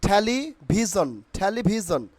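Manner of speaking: "টেলিভিশন" said theli-bhizon; careful "টেলিভিশন", z-like sound before "on"; corrected pronunciation. Shown as a demonstration. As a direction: say "টেলিভিশন" with puffed t and bh. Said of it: "কি হবে?".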